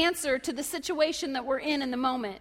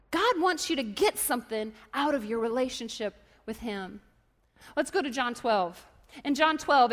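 ordinary talk, nothing marked

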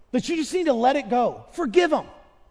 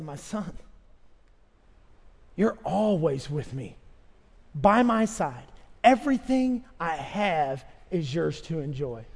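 tender father; 5 semitones lower, slower than usual. Acted. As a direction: gently reassure a son. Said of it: "The father said".

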